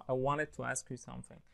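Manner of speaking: "wanted" as "wanned", merged into "to"; sounds merged together. Shown as a demonstration. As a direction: blend "wanted to" casually, with no T after the N. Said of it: "بيضغموها مع بعض".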